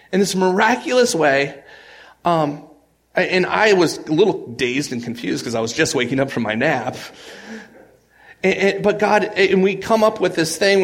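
loud, high, driving sermon voice; preaching at full force. Acted. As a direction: preach it out with rising fire. Retelling the miracle at full force.

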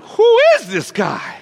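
high, loud, drawn-out disbelief; slow, amazed exclamation at full voice; astonished outburst. Acted. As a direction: cry out in stunned awe. Acted awe after storm is stilled.

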